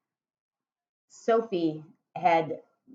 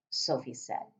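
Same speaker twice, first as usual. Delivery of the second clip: ordinary delivery, nothing marked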